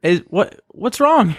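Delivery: high, gentle worried asking; concerned check-in. Retold or imitated. Roommate retelling knocking on upset friend's door, high concerned asking. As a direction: coax her through the door.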